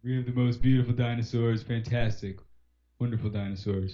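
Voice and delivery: mock politician's boastful superlatives; Trump.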